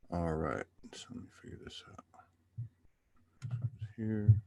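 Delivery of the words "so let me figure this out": quick whisper while fiddling with the setup; hushed quick aside; whispered to self. Real, not acted. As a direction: murmur it under your breath, fast. Host muttering setup to himself.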